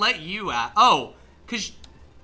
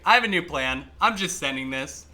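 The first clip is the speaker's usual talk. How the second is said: normal talking voice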